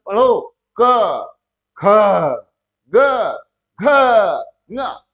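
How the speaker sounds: loud chanting recital, spaced letters; primary school teacher. Retold or imitated. Teacher imitates his old guruji drilling letters loudly. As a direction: call out letters like a village teacher. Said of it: "हमारे गुरुजी क्या पढ़ाते थे".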